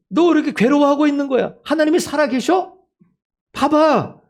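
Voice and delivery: high, forceful challenge; fervent rebuke. Acted. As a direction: press each question on the disheartened believers. Preacher challenges the despairing believers. (높고 힘있게 꾸짖듯 호소)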